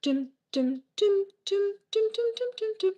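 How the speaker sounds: high sung dum dum notes; imitated xylophone beat. Shown as a demonstration. Mocking the annoying pop songs, high imitated xylophone dums. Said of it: "this whole, like".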